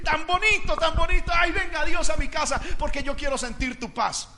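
high-pitched mock delight; mock gushing. Preacher imitating someone inviting God for peace, mock gushing. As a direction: gush high, imitating a feel-good believer. Mocking those who only seek feelings.

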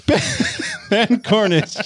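laughing. Real, not acted. Laughing through introduction.

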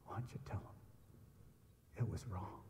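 soft unvoiced whisper; whispered divine prompt. God's quiet prompt to the preacher, whispered. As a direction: let it come as an inner nudge. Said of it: "I felt like God said".

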